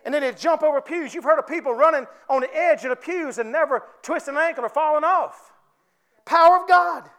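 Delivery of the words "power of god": loud, high cry of praise; fervent exclamation. Real, not acted. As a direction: proclaim it loudly, voice lifted. Preacher exclaims.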